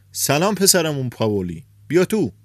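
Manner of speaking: high, loud welcoming call; shouted greeting. A narrator's reading. Café patron calls the young man inside, loud shout. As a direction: hail him across the room. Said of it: "مانلوکاس داد زد".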